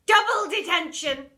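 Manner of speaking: loud, punched DOUBLE; shouted punishment. Acted. Miss Seeth punishing pupil, shouting. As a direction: bark it at the pupil.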